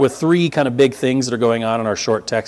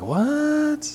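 mock double take; 11 semitones higher, 6 dB quieter than usual.

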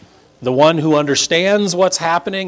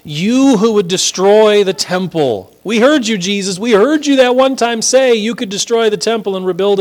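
louder, higher taunting jeer; mocking crowd; 5 semitones higher, 3 dB louder than usual. Preacher voicing the crowd's jeering, louder and higher. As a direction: taunt him like the passing crowd. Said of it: "an old way of sort of mocking".

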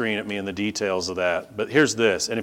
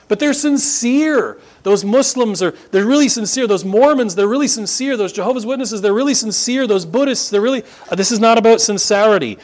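preaching at full force; 11 semitones higher, 5 dB louder than usual. Acted. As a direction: hammer home the shocking survey.

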